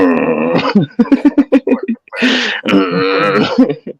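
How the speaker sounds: mock animal growl, drawn out; imitated cross mother growl. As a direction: growl like an annoyed animal mum. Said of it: "She was getting a bit cross there".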